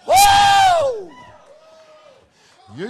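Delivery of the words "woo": very high, loud preacher's whoop. Real, not acted. Preacher whooping to the congregation, high and loud. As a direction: let out a joyful whoop.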